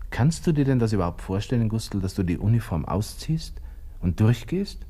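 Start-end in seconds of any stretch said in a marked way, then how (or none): none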